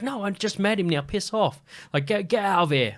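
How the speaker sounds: high-pitched imitation Irish accent; thick Irish accent. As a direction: mimic an Irishwoman sending someone packing. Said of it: "this fucking thick Irish accent".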